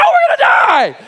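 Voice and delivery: very high, loud, wailed through laughter; hammed-up cry of doom; laughing mock panic. Pastor retelling the floaty escape, laughing mock wail.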